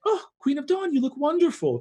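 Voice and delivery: high-pitched gushing flattery; Queen of Midday. Acted. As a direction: coo the compliment way up high. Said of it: "Queen of Midday is like".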